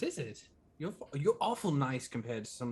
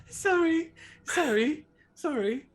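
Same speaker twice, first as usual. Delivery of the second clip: laughing apology; 7 dB louder, slower than usual. Real, not acted. Laughing apology after laughing fit.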